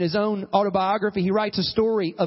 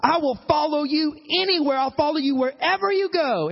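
put-on eager young follower; 5 semitones higher than usual. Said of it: "This young guy comes up to him and he says".

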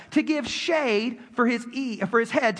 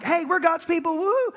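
higher, whooping self-congratulation; mock cheering; 8 semitones higher than usual. Mocking Israel cheering that they are chosen, whooping. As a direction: play it like a smug fan chant. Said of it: "was not just being like".

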